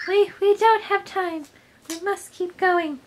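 high, put-on princess; urgent princess voice. Princess urging heroes onward after losing Bowser, high character voice.

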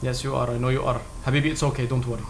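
very soft, low reassurance; soothing. Acted. Player telling a teammate it's okay, soothing. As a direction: hush and console, deep and quiet.

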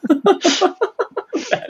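slow, breathy words through a laugh; slowed-down words dissolving into laughter. Real, not acted. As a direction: say it while still giggling. Host laughing after story.

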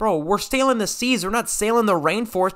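high, incredulous protest; exasperated objection. Real, not acted. Player to friend adding trees, exasperated objection. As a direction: pitch it way up in disbelief.

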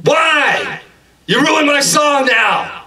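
high-pitched comic rant, easing slightly; shouted scolding. Acted. Customer to worker who ruined his song, high yelling complaint.

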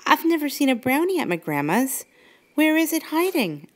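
put-on high, curious little girl; a child asking eagerly; puzzled girl voice. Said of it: "asked Brooke".

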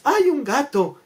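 high-pitched mock alarm; frightened lady. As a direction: act startled by a harmless cat.

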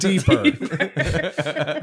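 high, laughing mock moan. Joking on sexual toy.

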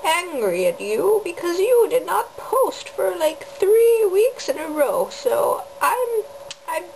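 high, slow scolding grandma; old grandma voice. Pretend. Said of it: "I'm being an old grandma now".

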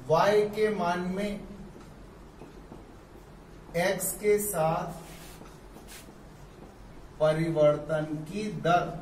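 slow, with long pauses between phrases; teacher reading the rate of change slowly; slow careful reading. Shown as a demonstration. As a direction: dictate it phrase by phrase. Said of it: "ऐसे भी पढ़ते हैं".

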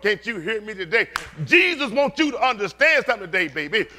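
high, loud, excited preacher; full-force preaching. Real, not acted. Preacher telling the church what emphatic means, fired-up preaching.

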